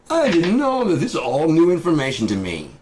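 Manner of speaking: very high, loud squeal sliding down; mock shocked innocence. Company man confronted with Nazi links, squealing mock innocence.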